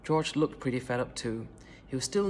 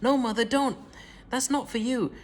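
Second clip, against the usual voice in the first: very high loud panicked no; frantic cry; 8 semitones higher, 4 dB louder than usual. A narrator's reading. Daughter begging Grandma not to drink, frantic. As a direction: stop her mother at once. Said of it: "cried Missus Cranky".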